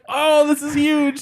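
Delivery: mock thrill. Thrilled by partner's gaffe.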